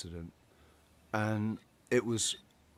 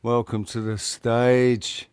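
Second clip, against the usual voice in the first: slow, louder mock stage announcement; announcer welcome; 7 dB louder, slower than usual. Host introducing Kieran to the stage, slow and louder, announcer-style. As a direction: bring the guest on like a show host.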